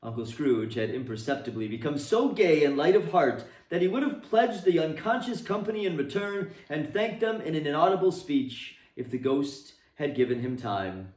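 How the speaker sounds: ordinary delivery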